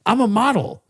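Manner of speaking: high, prissy mock golfer; mock model guy. Imitating the filming golfer to a friend, high and prissy. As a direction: play the vain golfer up high. Golfer voiced in retold argument.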